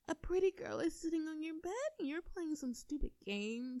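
normal talking voice

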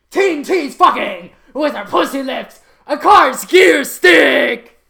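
loud high shout, slowing at the end. Acted. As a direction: scream it till it hurts. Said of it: "That hurt my voice".

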